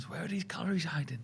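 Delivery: hushed conspiratorial whisper. Real, not acted. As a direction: breathe it like a secret. Conspiratorial question about beer.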